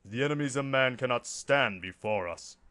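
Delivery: loud, commanding soldier; Space Marine voice. Shown as a demonstration. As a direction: boom it out like an armored warrior. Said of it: "That's a bit better".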